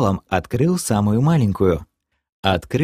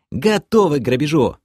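higher, eager character voice; keen medic reporting for the raid; soldierly report; 5 semitones higher than usual. A narrator's reading. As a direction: announce readiness at attention. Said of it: "вытянулся в струнку лекарь".

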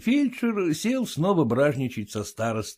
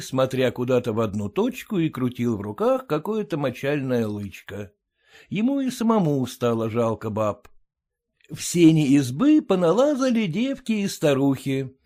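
plain reading voice

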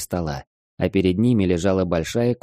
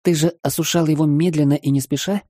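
put-on young girl voice; 8 semitones higher than usual. Girl keeps asking.

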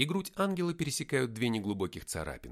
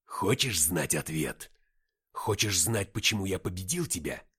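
victor's question; 2 semitones lower, 5 dB louder than usual. A narrator's reading. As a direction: taunt the beaten foe with weighty questions. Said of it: "Деймос спросил".

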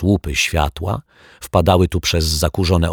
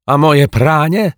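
much higher and louder call; calling after him; 10 semitones higher, 7 dB louder than usual. A narrator's reading. Mrs Majewska calling after departing Martwiak, loud and high. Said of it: "zawołała za nim pani Majewska".

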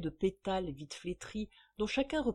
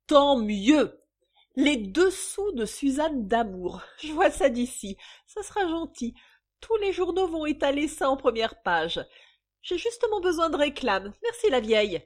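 high, loud mocking delight; gleeful taunting; 5 semitones higher, 7 dB louder than usual. Mistress welcoming scandal as publicity, taunting. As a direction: crow over the threat. Mistress mocks the creditor's threat.

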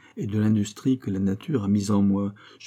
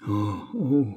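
wailed "oh, oh" of despair.

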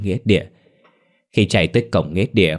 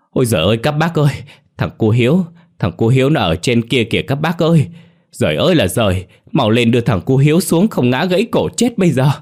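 higher, louder, urgent cry to the others; alarmed shout; 6 semitones higher, 4 dB louder than usual. A narrator's reading. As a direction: shout in alarm at what he sees. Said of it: "có một chú người làng rú lên". (người làng rú lên hốt hoảng)

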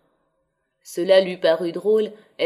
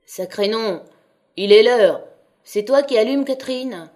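loud, higher bark of a sleepy father; gruff father shouting; 3 semitones higher, 7 dB louder than usual. A narrator's reading. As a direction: wake the house with a groggy yell. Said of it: "la voix de Maheu bégayait, empâtée". (père furieux qui crie, voix pâteuse)

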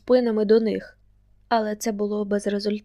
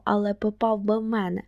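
ordinary delivery, nothing marked

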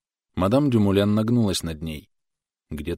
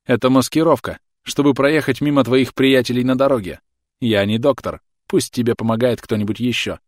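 gleeful; 5 semitones higher, 6 dB louder than usual. A narrator's reading. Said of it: "радостно ответил француз".

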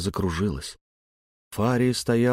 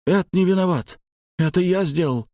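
urgent protest; 9 semitones higher than usual. Acted. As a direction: blurt it out to shield your friend. Friend defends narrator.

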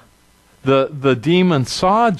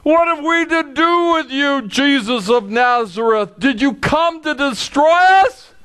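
high, pleading cry of the demon; a frightened demon crying out at Jesus; hammed-up demon crying out; cried out; 9 semitones higher than usual. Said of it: "he cried out".

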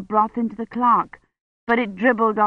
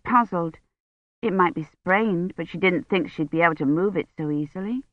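plain reading voice

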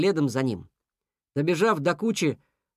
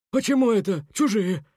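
higher, mildly outraged beaver; indignant; 4 semitones higher than usual. A narrator's reading. Beaver protesting Fufu's charge, indignant. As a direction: protest the accusation. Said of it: "возмутился Бобёр".

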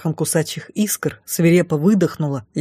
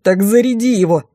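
higher, urgent bark; tense raised retort; snapped order; 4 semitones higher, 4 dB louder than usual. A narrator's reading. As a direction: snap back a command. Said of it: "рявкнула я".

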